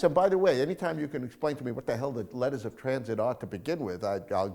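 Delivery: normal talking voice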